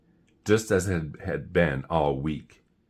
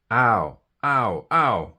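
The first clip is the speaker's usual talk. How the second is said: higher, louder cries of pain; 5 semitones higher, 4 dB louder, much slower than usual. Acted. Jim after eating the green banana, crying out in pain. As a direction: yelp from a sore tummy.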